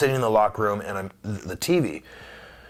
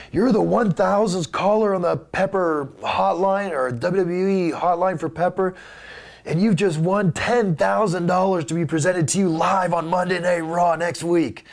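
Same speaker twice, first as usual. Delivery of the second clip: hotline prize announcer; 9 semitones higher, 4 dB louder than usual. Retold or imitated. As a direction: announce a big prize like a hyped radio host. Fake hotline prize announcement to callers.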